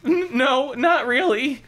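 put-on high, stammering kid voice; high flustered denial; embarrassed boy. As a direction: stumble over it like a shy boy.

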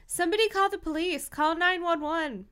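high, urgent call for help; frightened shouting. Acted. Frightened voices in the crowd, urgent calling out. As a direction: voice a panicked onlooker, not full volume. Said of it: "Frightened voices competed with sobs of horror".

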